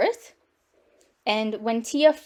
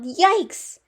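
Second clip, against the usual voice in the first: high yelp of alarm; startled yell; 7 semitones higher than usual. A narrator's reading. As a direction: yell it as a shocked father. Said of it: "turned around and yelled".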